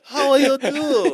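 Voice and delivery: high, loud, slow mock Japanese accent. Joking as someone newly arrived from Japan, Japanese accent. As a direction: greet them in exaggerated Japanese-accented English. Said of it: "fresh off the boat from Japan".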